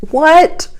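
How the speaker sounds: high, loud rising what; startled what. Retold or imitated. Asking the Holy Spirit for help, high startled rising question. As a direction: let the surprise jump out. Retold prayer, sudden surprise.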